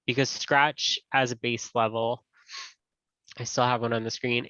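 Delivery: usual speaking voice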